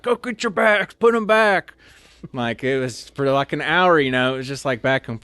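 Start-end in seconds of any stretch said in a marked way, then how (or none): 0.0-1.7 high, loud shouted order; drill sergeant yell. Retold or imitated. Drill sergeants smoking new recruits, loud shouting. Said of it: "They started smoking us".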